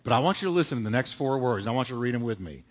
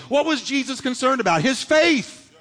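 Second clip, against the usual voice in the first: higher and louder, building up; preaching climax; 8 semitones higher, 4 dB louder than usual. Real, not acted. Preacher crying out to the church. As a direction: ring it out to the congregation.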